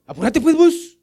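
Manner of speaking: high, loud, demanding with a laugh; mock impatient demand. Shown as a demonstration. Preacher mocking a believer who gives God orders, high and loud. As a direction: act out a pushy, demanding prayer. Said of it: "¿se cambia la voz o no se cambia la voz?".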